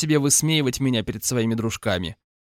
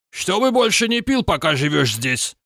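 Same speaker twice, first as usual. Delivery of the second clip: domineering roommate; 4 semitones higher than usual. A narrator's reading. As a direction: lay down the law. Roommate laying down rule. (властный приказ)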